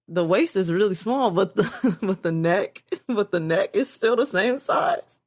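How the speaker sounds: telling it through giggles; laughing. Real, not acted. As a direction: struggle to get the joke out without laughing. Retells friend's joke, laughing.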